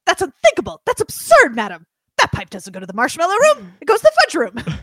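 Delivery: loud, high, theatrical protest; hammed-up outraged correction; mock indignation. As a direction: play it scandalized and emphatic. Acts out Wonka's indignant reply.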